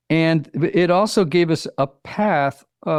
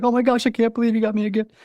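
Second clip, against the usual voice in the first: fast, higher, touched gush; teary gratitude; 8 semitones higher, much faster than usual. Pretend. Wife getting teary over a gift, fast touched gush. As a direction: rush it out, half-welling up with thanks. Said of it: "you get a little teary".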